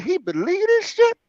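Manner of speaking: high, disbelieving inner voice; squeaky amazed disbelief; incredulous. Retold or imitated. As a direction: still can't believe it. Repeats disbelief.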